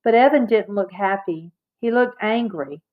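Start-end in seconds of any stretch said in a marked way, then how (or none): none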